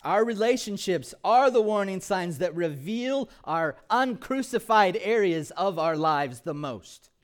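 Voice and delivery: loud, high, ringing sermon voice; full-force preaching. Acted. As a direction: preach it out with fire.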